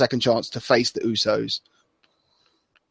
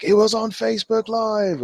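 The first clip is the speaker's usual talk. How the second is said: high-pitched commentator imitation; put-on high announcer quote; JBL; 10 semitones higher than usual. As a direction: mimic a commentator calling out, pitched way up. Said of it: "J B L just like".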